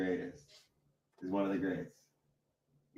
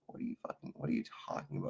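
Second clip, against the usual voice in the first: incredulous whisper; 6 semitones higher, 10 dB quieter, much faster than usual. Retold or imitated. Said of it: "And I'm just like".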